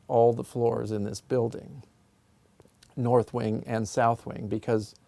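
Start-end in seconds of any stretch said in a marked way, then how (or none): none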